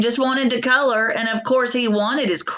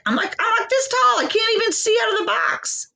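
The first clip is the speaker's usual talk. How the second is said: much higher squeaky little crayon complaining; tiny crayon voice; 14 semitones higher than usual. Retold or imitated. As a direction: pitch it way up like a tiny stubby crayon. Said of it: "but he's like".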